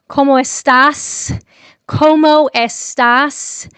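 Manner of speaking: slow, loud gringo "¿Cómo estás?" with a hard, hissed S on "estás"; mock the way a gringo says "¿Cómo estás?", dragging it out; gringo Spanish. Shown as a demonstration. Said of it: "como un gringo, you sound like that".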